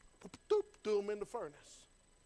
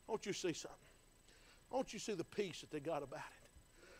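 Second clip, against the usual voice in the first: much softer and lower, drawing them in; hushed appeal; 6 semitones lower, 9 dB quieter than usual. Acted. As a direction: lean in and nearly murmur it. Preacher drops to hushed appeal.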